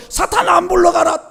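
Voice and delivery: loud, high shout at Satan; shouted rebuke. Retold or imitated. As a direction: command Satan to flee with everything. (꿈속에서 사탄을 쫓아내며 외치는 호령)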